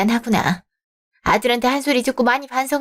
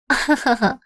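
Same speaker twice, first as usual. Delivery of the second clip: polite laugh. Acted. In-laws greeting at first meeting.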